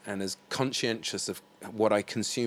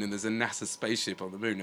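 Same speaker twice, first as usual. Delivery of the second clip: ordinary delivery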